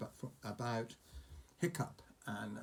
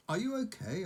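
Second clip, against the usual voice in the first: put-on higher concerned boy; worried boy; 8 semitones higher than usual. Fishlegs checking on bitten Hiccup, higher. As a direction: ask it up high, worried.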